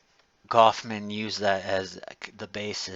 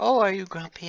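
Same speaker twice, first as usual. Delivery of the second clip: much higher, louder sing-song fake sweetness; sugary waitress; 10 semitones higher, 4 dB louder, faster than usual. Waitress teasing a grumpy customer, high fake sweet voice. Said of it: "she's like".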